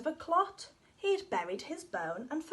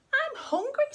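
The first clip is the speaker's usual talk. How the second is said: high pleading whine; high whiny hungry; 11 semitones higher, 5 dB louder than usual. Acted. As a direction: whine like a starving pup. Dog pleads hunger.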